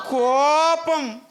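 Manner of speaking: కోపం stretched long and loud; drawn-out stress. Shown as a demonstration. Preacher answering the congregation, stretching కోపం loud and slow. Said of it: "first పాయింటే ఏంటంట".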